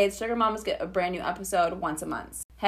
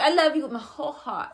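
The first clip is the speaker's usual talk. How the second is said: imitating toddler; 7 semitones higher than usual. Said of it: "She's like".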